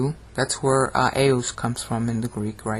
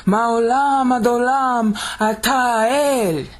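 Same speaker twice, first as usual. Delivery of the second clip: high, slow, sustained proclamation; thundering Hebrew; 9 semitones higher, 4 dB louder, much slower than usual. Shown as a demonstration. Teacher declaiming an often-cited verse, thundering. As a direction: let the whole line ring out as a chant. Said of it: "the thundering Hebrew".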